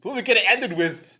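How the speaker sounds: Christopher Walken. Retold or imitated. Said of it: "Christopher Walken".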